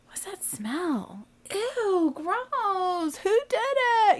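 high, put-on kid voice, whisper turning to squeal; kids voiced. Kids in the back seat catching a stink, high kid voice. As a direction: play the kids sniffing, then outraged. Kids noticing a smell in car.

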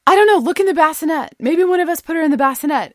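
replayed loud, high urgency; urgent suggestion. Frantic search, wife suggests bassinet.

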